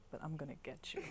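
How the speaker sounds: usual speaking voice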